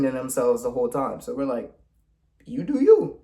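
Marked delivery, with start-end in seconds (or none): 2.4-3.2 high, put-on "suit yourself" voice; mock-breezy dismissal. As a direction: toss it off high, imitating a breezy brush-off. Retold dismissive reply to the pair.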